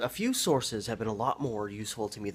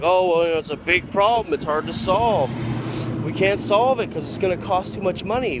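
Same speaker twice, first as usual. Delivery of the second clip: mocking; 9 semitones higher, 11 dB louder than usual. Mocking officials' excuses.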